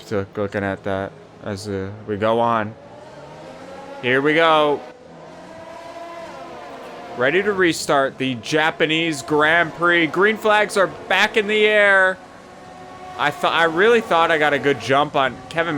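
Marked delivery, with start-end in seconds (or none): 4.0-12.2 mock race announcer. Mock race commentator restart call.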